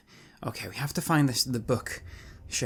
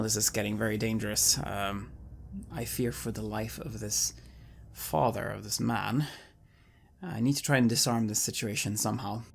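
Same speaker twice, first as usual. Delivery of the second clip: normal talking voice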